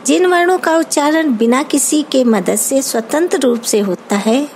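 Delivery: normal talking voice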